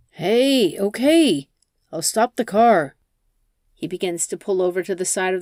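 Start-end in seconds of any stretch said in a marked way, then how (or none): 0.1-3.0 higher, louder nervous giving in; calm her before she stabs; nervous placating. Acted. Jason, scared, tries to calm the armed passenger.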